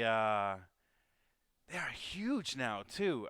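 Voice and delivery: usual speaking voice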